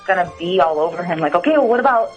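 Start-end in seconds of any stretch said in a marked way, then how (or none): none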